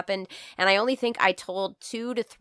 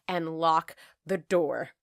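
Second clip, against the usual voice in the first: ordinary talk, nothing marked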